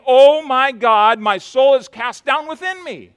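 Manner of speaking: slow, loud lament to God. Retold or imitated. David lamenting his cast down soul, loud and slow. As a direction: cry out the psalm in despair. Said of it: "He laments in his despair".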